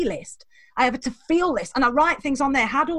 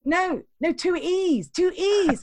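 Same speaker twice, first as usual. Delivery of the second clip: hint of Scottish accent; 5 semitones higher, much slower than usual. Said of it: "And I was like".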